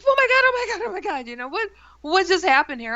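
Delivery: high-pitched retold freak-out; panicked. Roommate comes to in the car, panicky. Friend coming to, acted panicking.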